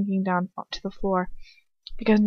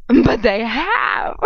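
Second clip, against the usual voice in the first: drawn-out rising shriek into a cackle; gloating villain; 8 semitones higher, 4 dB louder, much slower than usual. A narrator's reading. Bellatrix contradicting Ron, gloating shriek. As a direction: crow it slowly, then cackle.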